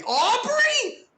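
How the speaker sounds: a high, loud cry of disbelief; shocked shout. Real, not acted. Gamer sees a friend changed and shouts her name in shock.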